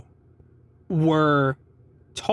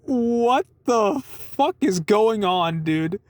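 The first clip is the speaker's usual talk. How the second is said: stunned disbelief; 4 semitones higher, much slower than usual. Retold or imitated. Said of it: "I was like".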